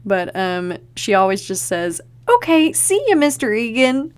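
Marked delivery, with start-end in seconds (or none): 2.3-4.1 much higher, louder, chirpy goodbye; breezy and cheerful, all is well; hunky-dory. Retold or imitated. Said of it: "It's just very hunky-dory".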